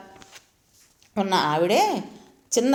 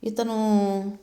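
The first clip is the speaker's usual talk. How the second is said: usual speaking voice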